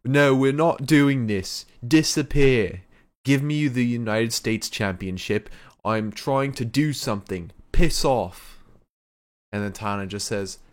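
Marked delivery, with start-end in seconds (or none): 0.1-8.6 loud, accented impression of an irate wrestler; Will Ospreay. Wrestler spots his rival coming out, loud indignant accented impression. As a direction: refuse loudly in the wrestler's accent, pitch raised at first. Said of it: "the most spot-on impression you've ever done".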